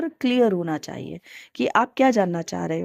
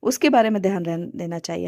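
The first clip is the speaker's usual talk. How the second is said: normal talking voice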